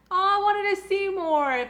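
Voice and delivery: high, loud pleading whine. Retold or imitated. Fan reacting to the episode cutting away, high pleading whine. Recalling her own disappointed reaction.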